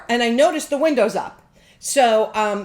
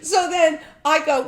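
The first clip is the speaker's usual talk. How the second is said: laughing; 7 semitones higher, 5 dB louder than usual. Real, not acted. Laughing while telling story.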